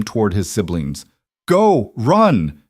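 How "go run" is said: loud desperate command; urgent shout. Acted. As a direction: yell at them to run. Urging children to flee.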